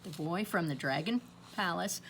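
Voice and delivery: plain reading voice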